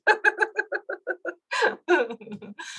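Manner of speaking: high, drawn-out giggle; helpless laughter. Real, not acted. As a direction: laugh at your own odd sounds. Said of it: "they're just making me laugh".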